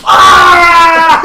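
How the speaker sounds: loud, high hammed-up yell of relief; mock scream. Gamer acting out Tomoya's bathroom relief, a loud mock yell. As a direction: belt out a cartoonish scream. Imitating character's bathroom outburst.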